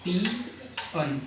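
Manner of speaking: पियन drawn out very slowly and softly, each sound clear; teacher modelling the word पियन slowly and quietly; slow careful pronunciation. Shown as a demonstration. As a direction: stretch पियन out slowly to show how it sounds. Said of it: "इसका जो उच्चारण है".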